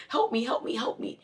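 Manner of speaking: high pleading cries of help me; mock plea for help. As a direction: whine it like a helpless patient. Mock patient pleading again.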